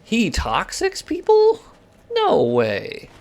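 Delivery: high, slow, stretched-out disbelief; can't believe what he just saw; shocked disbelief. Real, not acted. Gamer stunned the enemy player toxics, high, slow disbelief.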